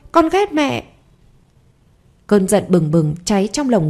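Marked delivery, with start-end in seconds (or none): 0.1-0.8 put-on much higher childish voice, falling hard; the final sulky blow to mum; child's outburst. A narrator's reading. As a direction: son yelling he hates his mother, higher voice. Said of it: "nó đẩy mạnh cô hơn".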